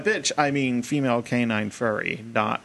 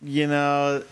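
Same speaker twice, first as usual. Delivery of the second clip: ordinary delivery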